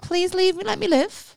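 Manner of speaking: high, whiny plea to be left alone; hammed-up pleading; mock plea. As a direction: beg the app in a high squeak. Pleading with watch app.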